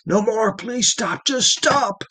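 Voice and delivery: high, pained pleading; tortured man pleading with his torturer; desperate begging. Acted. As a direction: beg him to stop, voice up high. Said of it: "he begged".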